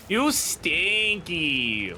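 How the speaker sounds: stretched teasing drawl; slow mock scolding. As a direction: drag it out like scolding a kid. Teasing repeat, slower, far.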